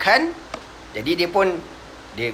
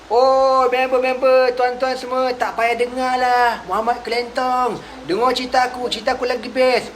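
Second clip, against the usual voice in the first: much higher, showy hawker's voice; boastful storyteller; 8 semitones higher than usual. Retold or imitated. Nadr pulling listeners from the Prophet, high hawker voice. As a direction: lure the crowd away, higher and showy. Nadr luring crowd away.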